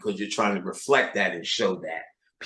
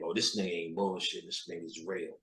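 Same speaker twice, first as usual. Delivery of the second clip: hushed aside; 5 semitones lower, 13 dB quieter than usual. Acted. Voicing others' inner verdict.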